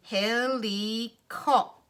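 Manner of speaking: HELICOP very slow, in three drawn-out chunks; robot talk. Shown as a demonstration. Teacher breaking the word down for children, slow chunked HELICOP. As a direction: stretch out each syllable of HELICOP like a robot. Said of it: "Let's break the word down".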